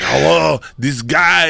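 Mark Wahlberg. Acted. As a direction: punch up a botched movie-star greeting. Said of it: "He's like".

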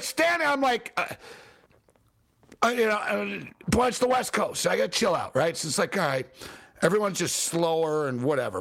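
ordinary talk, nothing marked

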